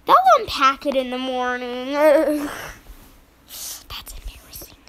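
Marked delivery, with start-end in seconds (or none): 3.5-4.8 unvoiced whisper; quiet embarrassed whisper; whispered aside. Real, not acted. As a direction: whisper it to camera.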